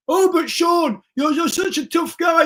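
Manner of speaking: high, sneering mock of a critic; a hammed-up jeer at the tough guy. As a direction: taunt him like a heckler, high and loud. Mocking imagined critic.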